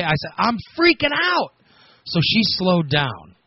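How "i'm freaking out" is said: panicked cry. Retold or imitated. Answering Kayla while trapped, panicking.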